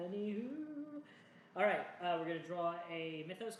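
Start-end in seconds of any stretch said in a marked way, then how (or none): 0.1-1.0 high, drawn-out singsong after a laugh; silly singsong. Real, not acted. Player moves on after goofy banter, silly singsong. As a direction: stretch it out high and playful.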